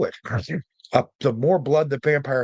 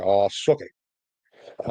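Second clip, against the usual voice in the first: slow, drawn-out put-on voice; lightly voiced sneering dismissal; mock quote; 3 semitones lower, much slower than usual. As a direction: drag it out like you're imitating someone. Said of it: "There's a reason he said".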